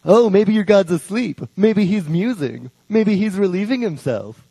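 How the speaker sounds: light sing-song ridicule; gently hammed-up scornful jeer; mocking taunt. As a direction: poke fun at the rival god, teasing. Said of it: "This is what Elijah's doing".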